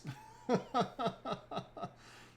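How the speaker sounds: long, high run of giggles; high, rippling laughter; amused laugh. Real, not acted. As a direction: laugh it out in a rapid string. Laughing at chat messages.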